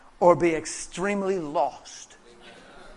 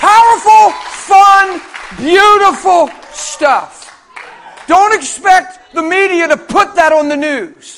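loud, high fervent preaching; full-force preaching; 10 semitones higher, 12 dB louder than usual. Acted. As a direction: preach it out with all your fire.

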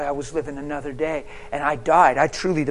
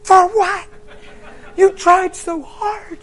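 high, loud, drawn-out wail; mock-crying protest, slow and high; retold bawling; 7 dB louder, slower than usual. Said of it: "I'm bought".

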